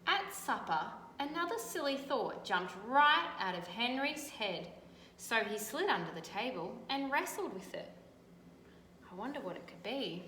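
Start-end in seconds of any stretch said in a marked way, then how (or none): 0.1-7.9 louder, animated read-aloud; storybook reading. A narrator's reading. Teacher reading to children, animated storytelling. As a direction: make the tale vivid for kids.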